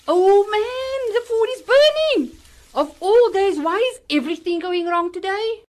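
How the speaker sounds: high, loud panic; alarmed. Acted. Mother notices the food burning, loud and alarmed. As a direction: cry out as the pot burns.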